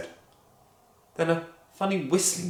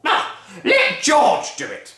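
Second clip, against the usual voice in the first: sneering Grandma; 6 semitones higher, 6 dB louder than usual. Acted. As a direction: sneer at George. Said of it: "Grandma shouted".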